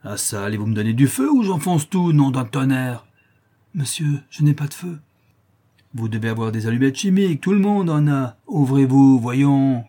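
bellowing lout demanding a light for his pipe; drunken brute. Acted.

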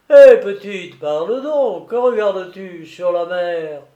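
tipsy keeper. A narrator's reading. Keeper calling to the girl at the window, jovial. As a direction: call out heartily to the girl.

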